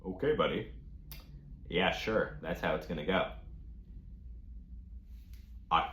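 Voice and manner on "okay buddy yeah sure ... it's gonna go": low, soft, unimpressed retort; dismissive calm. Retold or imitated. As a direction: answer the shouting quietly, low and dry. Calm retort to shouting roommate.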